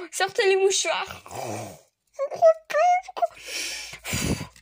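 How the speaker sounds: high, crying voice, barely getting words out; tearful disbelief. Real, not acted. Finding a rare card, crying with joy. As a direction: near tears of joy. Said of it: "je vais sortir le mouchoir".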